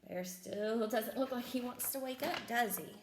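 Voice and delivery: ordinary delivery, nothing marked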